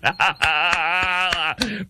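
high, rising burst of laughter; real laugh. Real, not acted. Host amused by protesters in towels, laughing. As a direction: laugh freely, climbing in pitch.

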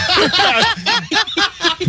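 witch cackle. Pretend. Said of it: "you're so good".

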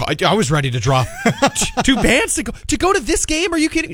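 worked up and laughing about dropping two grand; excited, rising high. Real, not acted. Excited high voice while bragging to the co-host.

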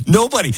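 Nobody leaps high with a laugh; Nobody, laughed out high as a scoffing stress; emphatic nobody. Real, not acted. Host scoffing to listeners: Nobody, high and amused.

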